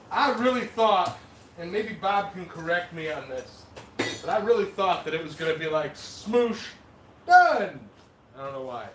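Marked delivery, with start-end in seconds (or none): 5.9-7.8 high sound-effect word, then a loud burst; punchy demonstrative exclamation. Acted. Host shows how he expected it to go, punchy exclamation. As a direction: act out a quick squash, ending high and loud. Acts out how metal should squash.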